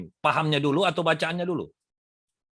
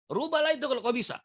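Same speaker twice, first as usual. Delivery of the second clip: ordinary talk, nothing marked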